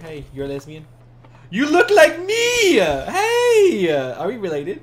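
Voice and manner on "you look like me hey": very high, drawn-out squeal; excited squeal. Pretend. Player greets a lookalike in the game, excited squeal.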